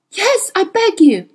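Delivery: high, anguished plea; frantic cry, pitched high; desperate begging. A narrator's reading. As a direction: beg as if under torture. Tortured witch begs her captor.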